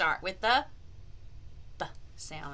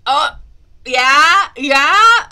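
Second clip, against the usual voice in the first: much louder cheering yeah; loud excited yeah; 5 semitones higher, 20 dB louder, much slower than usual. Acted. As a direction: cheer in agreement. Excited agreement.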